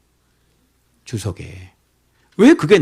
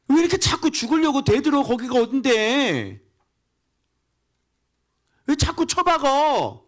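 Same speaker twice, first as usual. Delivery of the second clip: pitched way up, exasperated scolding; a pastor scolding the people in a high voice; high scolding preacher. Acted. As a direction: chide them like stubborn children. Scolding rebellious congregation, high.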